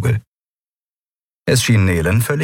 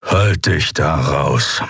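slow, low warning; menacing Keru; 3 semitones lower, much slower than usual. Acted. Keru warning Nelen to stay out, slow menace. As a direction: draw it out as a threat.

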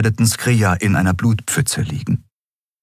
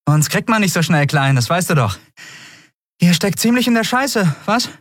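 high, teasing cheek; cheeky grin; 12 semitones higher than usual. Acted. Captain teases the admiral with a cheeky grin. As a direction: needle him with a cocky grin. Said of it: "setzte sie ein freches Lächeln auf".

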